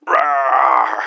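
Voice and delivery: loud growling rawr in an odd voice; hammed-up beast roar; monster roar.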